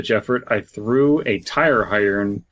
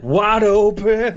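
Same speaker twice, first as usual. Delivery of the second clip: high, loud, slow wide open; mock dramatic callback; 11 semitones higher, 4 dB louder, slower than usual. Joking about police advice, echoing the reread in a high booming voice. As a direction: stretch wide open like a ham actor.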